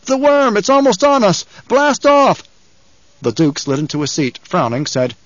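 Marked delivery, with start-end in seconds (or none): 0.1-2.4 high, loud, urgent cry; gasped alarm. A narrator's reading. Last man aboard warning of the worm, gasping alarm. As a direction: cry out a frantic warning, voice pitched way up. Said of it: "The last man came gasping".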